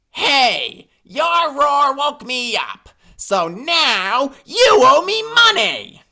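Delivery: high, slow demanding badger voice; grumpy honey badger. A narrator's reading. Badger to hippo, high slow demand. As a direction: demand payment for the noise.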